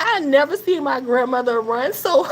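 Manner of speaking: laughing till near tears; laughing hard. Real, not acted. Narrator laughing at grandma running. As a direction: crack up mid-sentence.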